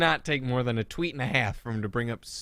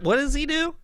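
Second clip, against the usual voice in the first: ordinary talk, nothing marked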